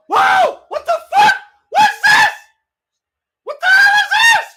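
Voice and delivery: high shrieking disbelief; shrieking jackpot winner. Real, not acted. Shocked by surprise entrance.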